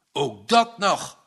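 slow, grim and loud. A narrator's reading. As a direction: bite it out grimly. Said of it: "zei hij grimmig".

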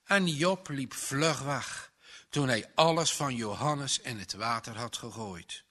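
ordinary delivery

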